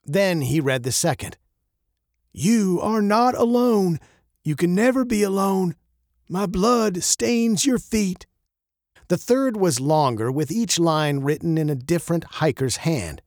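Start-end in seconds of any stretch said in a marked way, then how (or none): 2.3-8.3 slow, high, solemn reading; reading a note aloud. Acted. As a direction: read the note out slowly in a lifted voice.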